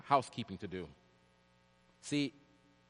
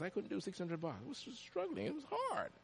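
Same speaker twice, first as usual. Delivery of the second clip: softer, much higher, weighed down; hushed and heavy; 7 semitones higher, 9 dB quieter than usual. Real, not acted. Preacher recalling his money struggle, hushed and heavy. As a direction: let the hardship sit in the voice.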